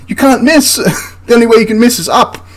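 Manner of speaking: very high, laughing rebuttal; laughing disbelief. Real, not acted. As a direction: argue it through incredulous laughter. Rebuts inaccuracy excuse while laughing.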